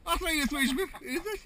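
thrilled shout over the catch; excited. Real, not acted. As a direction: rave about the size. Reacting to fish size.

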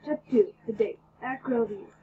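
usual speaking voice

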